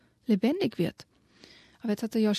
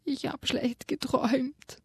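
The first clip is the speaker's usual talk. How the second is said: mild sob; 5 semitones higher, 4 dB quieter, slower than usual. Acted. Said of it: "kroch unter ihre Decke und schluchzte".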